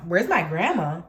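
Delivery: low, challenging repeat of the question; incredulous echo. Retold or imitated. Teller answers the woman's demand for grandma, low incredulous challenge.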